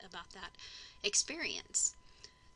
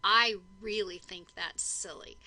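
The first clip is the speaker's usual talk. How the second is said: modeling a sentence; 8 dB louder, slower than usual. Shown as a demonstration. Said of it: "we might say something like".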